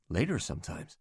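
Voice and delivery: quiet, trembling admission; shaking voice. Acted. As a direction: let the voice shake. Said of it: "My voice is shaking".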